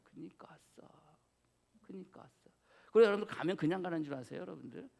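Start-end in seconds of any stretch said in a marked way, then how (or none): 0.0-2.5 whispered, much softer; whispered yay. Pretend. Pastor whispering his secret joy at not going.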